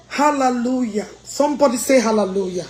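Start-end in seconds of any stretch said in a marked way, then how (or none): none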